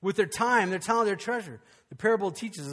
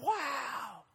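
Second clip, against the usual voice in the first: soft, high, awed "wow"; retold amazement; 7 semitones higher, 8 dB quieter than usual. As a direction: marvel quietly, pitched up. Retold amazement at singer's gift.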